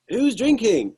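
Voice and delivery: high, slow, loud rallying call; Irish frontman revving up the pub crowd, slow loud call; charismatic Irish guy. Retold or imitated. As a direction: rouse the crowd like a showman frontman. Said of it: "he just goes".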